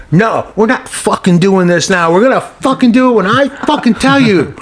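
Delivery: rising furious snap; angry outburst. Retold or imitated. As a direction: lash out at the crew. Star snaps at crew member.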